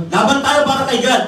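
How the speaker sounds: high, loud cheer for God; rally shout. Retold or imitated. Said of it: "may narinig ba?". (malakas na sigaw para sa Diyos)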